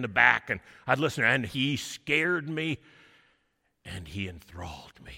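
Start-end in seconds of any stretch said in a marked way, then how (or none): 3.8-5.1 whispering. Acted. Awed recollection in story.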